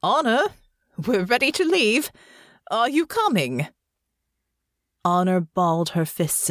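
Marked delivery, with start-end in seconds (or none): 0.0-3.7 father calling from downstairs; higher, projected call without full volume; booming. Acted. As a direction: call out to a daughter in another room. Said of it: "her father's booming voice".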